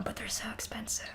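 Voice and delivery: a secret aside, breathed out; whispered aside. Real, not acted. Aside about cost.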